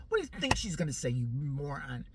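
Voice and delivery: ordinary talk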